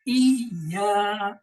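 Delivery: ای یا said slowly, the doubled ya split into two sounds; shadda demo. Shown as a demonstration. Teacher demonstrating how to read the shadda. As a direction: lean on the doubled ya in ای یا. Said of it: "دیکھیں اسے کیسے ادا کریں گے".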